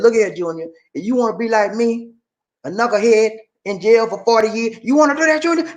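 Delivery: hammed-up jailhouse warning; inmate warning. Inmate warns boy.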